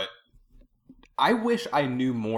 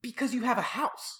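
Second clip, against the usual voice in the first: high, slow, indignant; incredulous retort; 13 semitones higher, 4 dB quieter than usual. Real, not acted. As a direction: spell it out in disbelief.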